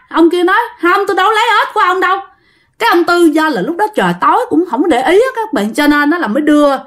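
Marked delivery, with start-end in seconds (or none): 0.8-2.2 high, very fast protest; protesting denial. A narrator's reading. Accused companion denying he took the frogs, quick high protest. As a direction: rattle off a high-pitched denial.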